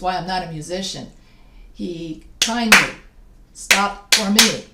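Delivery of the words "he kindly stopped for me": slow and loud, hammering "kindly" and "me"; stressed poem beat. Shown as a demonstration. Teacher claps along to Dickinson's line, heavy rhythmic stress. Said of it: "every time I stress a syllable".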